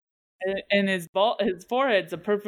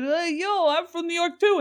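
mock New York accent, raised pitch; 9 semitones higher than usual. Dad tells strangers he's from New York, New York accent. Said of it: "I'd be like".

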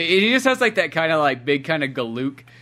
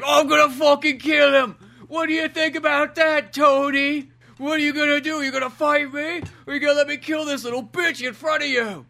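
taunting villain; 10 semitones higher than usual. Retold or imitated. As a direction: goad the hero in a cartoonish villain voice. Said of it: "the British guy's like".

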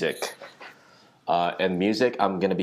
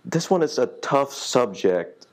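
raised voice; 8 semitones higher than usual. Real, not acted. Building to a tough subject.

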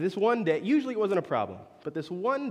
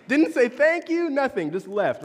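higher, louder incredulous burst; incredulous outburst; 7 semitones higher, 6 dB louder than usual. Real, not acted. Preacher to congregation, indignant disbelief. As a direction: blurt it out in disbelief. Indignant retelling of rude guest.

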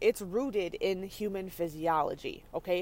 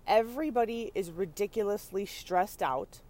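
ordinary talk, nothing marked

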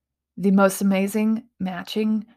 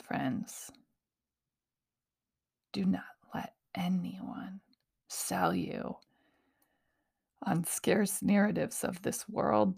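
whisper; 11 dB quieter than usual. Real, not acted. Intimate closing appeal.

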